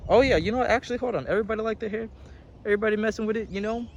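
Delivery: high, bubbly showing off; excited. Real, not acted. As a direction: show off the hair, delighted. Showing off her hair.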